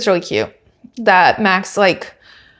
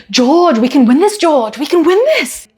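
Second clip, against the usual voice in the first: mock German accent, higher, yelling George's name; hammed-up German accent team boss cheering; loud German accent; 6 semitones higher than usual. Team boss shouts at George in German accent. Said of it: "this loud German accent yelling at you".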